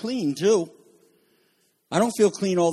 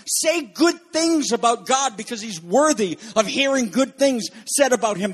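ordinary talk, nothing marked

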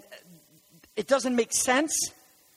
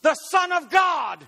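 high, slow shouted proclamation; preaching shout; 7 semitones higher, 4 dB louder, slower than usual. Real, not acted. Preacher proclaiming to the congregation, shouting. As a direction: belt it out from the pulpit.

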